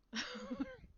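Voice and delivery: sudden high-pitched laugh; real laugh. Real, not acted. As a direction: burst out laughing. Laughs at her own anger.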